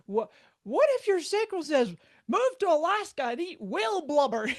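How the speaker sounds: Ra. Retold or imitated. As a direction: tease with an outrageous what-if. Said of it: "Like Ra would always say".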